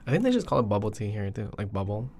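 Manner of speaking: ordinary delivery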